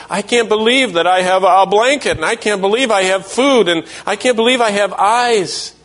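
put-on high amazed child voice; childlike wonder. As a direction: marvel like a small child at simple gifts.

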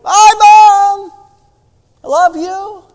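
put-on high, shaky little boy calling goodbye; a child calling back, voice trembling. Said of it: "in a shaky voice".

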